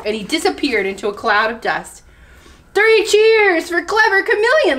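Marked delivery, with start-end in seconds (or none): 2.7-4.8 high-pitched, celebratory cheer; jubilant cheer. A narrator's reading. Monkey celebrating chameleon's victory, cheering high. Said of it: "laughed Monkey".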